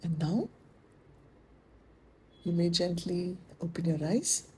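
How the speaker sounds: soft, unhurried guidance with long pauses; guided meditation. Acted. Teacher bringing students out of the chant, soft guided meditation. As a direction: ease them gently out of stillness, softly.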